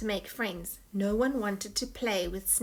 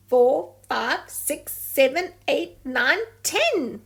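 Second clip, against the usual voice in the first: rising excited count; 6 semitones higher, 8 dB louder, slower than usual. Acted. Counting excitedly above water.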